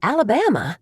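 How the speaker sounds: put-on a touch of a southern Alabama accent. A narrator's reading. Amy joins the chat in a light southern accent. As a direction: pipe up with a hint of a southern twang. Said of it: "piping up in her own accent".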